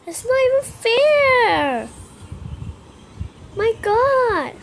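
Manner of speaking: high, loud, stretched-out whining; frustrated whine. Real, not acted. Frustrated gamer losing again.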